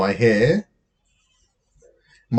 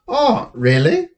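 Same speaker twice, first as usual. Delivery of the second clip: suspicious; 10 semitones higher, slower than usual. A narrator's reading. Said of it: "says Danny suspiciously".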